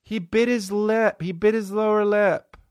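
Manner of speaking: high, breathless swooning over the groom; mock lovestruck sigh; swooning woman. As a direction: gush like a smitten royal-wedding fan. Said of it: "women watch it with their hands on their fucking chest".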